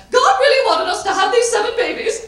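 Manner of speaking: high, loud, preachy imitation; mock self-righteous. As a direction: imitate sanctimonious parents proclaiming to the press. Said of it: "they were so self-righteous".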